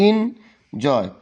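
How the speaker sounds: "Enjoy" slowly in two parts, en... joy; broken into syllables. Shown as a demonstration. Teacher repeating the example for students, "enjoy" slowly split in two. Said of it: "এটা দুইবারে উচ্চারণ হয়েছে".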